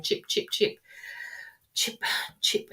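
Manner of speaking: soft breathy chip chip, like a bird call; whispered woodpecker chips. Retold or imitated. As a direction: tap it out like a woodpecker. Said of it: "like a woodpecker".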